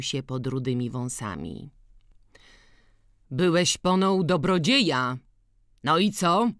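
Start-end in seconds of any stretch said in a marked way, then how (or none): 3.3-6.5 higher, pointed probing question; needling question. A narrator's reading. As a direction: prod him for an answer. Kowal zaczepia Antka złośliwym pytaniem. (zaczepne pytanie)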